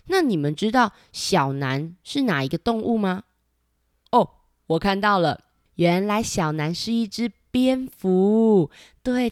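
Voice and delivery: ordinary delivery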